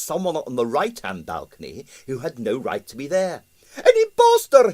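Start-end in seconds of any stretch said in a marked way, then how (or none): 3.8-4.7 very high loud indignation; outraged impresario; indignant. A narrator's reading. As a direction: spit it out indignantly. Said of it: "he said indignantly".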